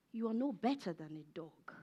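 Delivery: very soft, low, grave delivery; low and subdued, almost to oneself; hushed hurt. Acted. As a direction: let the insult land quietly. Grave retelling of felt insult.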